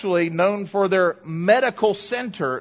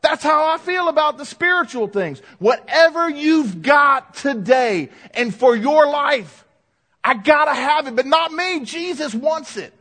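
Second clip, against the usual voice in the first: rousing the congregation like teammates; fired-up rallying cry; 7 semitones higher, 4 dB louder than usual. Acted. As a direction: carry the player's pep talk into the pulpit. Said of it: "getting them fired up".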